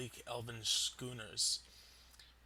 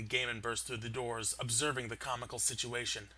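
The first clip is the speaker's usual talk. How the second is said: ordinary talk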